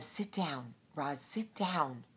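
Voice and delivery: embarrassed friend tugging her back quietly; hushed plea. Retold or imitated. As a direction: friend quietly and slowly urges Roz to sit. Friend hushing her at meeting.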